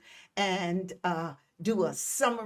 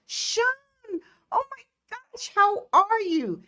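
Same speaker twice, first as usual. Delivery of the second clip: squealed much higher, then louder; high squeal of joy; delighted surprise; 12 semitones higher, 4 dB louder than usual. Real, not acted. Surprised to see viewer Sean.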